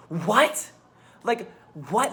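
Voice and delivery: loud, rising disbelief; can't believe she thought that; incredulous. Real, not acted. To viewers, real loud rising disbelief. Disbelief at her old fear.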